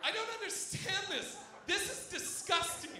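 high-pitched mock disgust; imitated appalled girls. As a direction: play horrified girls, voice up high, slowing into accusation.

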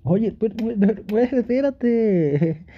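high and slowed, caught off guard; playful wait-wait; flustered surprise. Acted. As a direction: stammer it out, overwhelmed by kisses. Partner suddenly kisses him.

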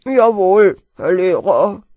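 loud, dutiful pupil's reply; meek schoolboy compliance; well-behaved. A narrator's reading. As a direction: answer obediently in the mumbler's voice. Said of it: "sagte Peter artig".

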